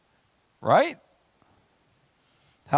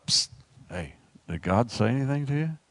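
hushed sneaky king; 6 semitones lower than usual. Acted. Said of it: "then you wanna know".

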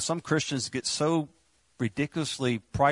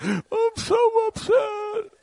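much higher, louder, slow mock sob; mock crying lady; 6 dB louder, much slower than usual. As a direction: wail it out like a teary lady with running mascara. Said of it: "when you all cry your mascara".

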